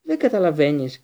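plaintive coward's protest; whimpering. A narrator's reading. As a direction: wail it helplessly. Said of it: "κλαψούρισε ο Πέντιγκρου".

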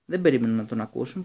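ordinary delivery

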